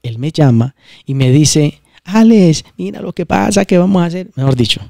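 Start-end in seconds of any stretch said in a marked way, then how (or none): 2.0-4.3 imitating a scared caller, pitched higher; a mock panicked plea on the phone; frightened. Colleague calling in alarm, imitated frightened higher voice. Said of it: "asustadísimo".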